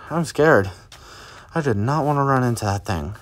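usual speaking voice

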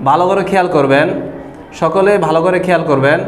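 ordinary talk, nothing marked

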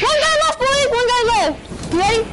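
high hyped rallying call; excited. Real, not acted. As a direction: pump the squad up. Final fight hype.

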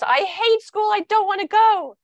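put-on high, loud whiny kid protest; protesting child. Child refusing school to a parent, high loud protest. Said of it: "when a child says".

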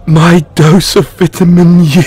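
playfully thrilled exclamation; excited. Acted. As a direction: cry it out, thrilled. Delighted at the pickup line.

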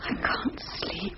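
hushed, slow whisper in the dark. Acted. Wife whispering to her husband in bed at night, hushed whisper. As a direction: breathe it so as not to wake him.

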